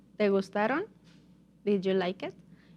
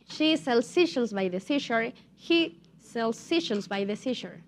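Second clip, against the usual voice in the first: tongue twister run together more quickly; faster demonstration. Shown as a demonstration. Teacher repeats the tongue twister for students, a bit faster. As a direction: pick up the pace, no gaps between phrases. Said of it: "now a little bit faster".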